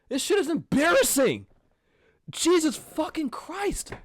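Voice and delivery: real anger, pitched high, punching the swear words; exasperated rant. Real, not acted. Podcaster fuming at fellow hosts' immaturity, exasperated rant. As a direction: let the disgusted outrage pour out, high.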